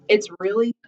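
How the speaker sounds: ordinary talk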